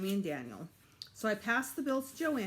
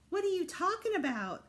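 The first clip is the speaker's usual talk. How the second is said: a hint of mock whiny echo; mocking mimicry; 11 semitones higher, 3 dB louder than usual. Brother mimics him to tease him, mocking. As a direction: parrot it back to tease. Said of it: "Alex mimicked".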